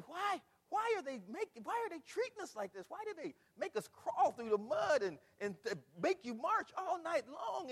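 high-pitched, aggrieved protest; acted recruit's complaint. Preacher recalling boot camp treatment, high indignant complaining. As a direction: wail the questions like a put-upon trainee.

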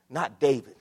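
ordinary talk, nothing marked

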